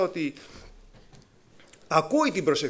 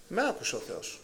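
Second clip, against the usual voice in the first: much softer, lower settled statement; quiet certainty; 3 semitones lower, 9 dB quieter than usual. Shown as a demonstration. Believer quietly assured that God has heard. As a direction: say it softly as settled fact. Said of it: "«Μ' άκουσε ο Θεός;»".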